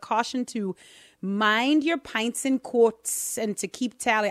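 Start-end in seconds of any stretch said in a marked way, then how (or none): 1.2-4.3 exaggerated, slow Irish accent. Host playfully explaining an old pub caution in an Irish accent. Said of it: "had to do it in an Irish accent".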